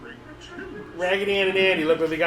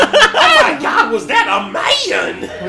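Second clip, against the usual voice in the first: much higher, louder, slow, laughing shriek; scandalized squeal, drawn out; hammed-up shock at seeing a man; shocked gasp; 13 semitones higher, 8 dB louder, much slower than usual. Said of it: "She goes".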